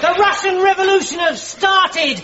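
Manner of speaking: high, proclaiming news report; excited announcement. Acted. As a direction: proclaim momentous news to an officer. Announcing the revolution to officer.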